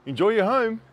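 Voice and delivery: hint of a breezy tradesman, mocking; mock builder. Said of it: "received from the builder saying".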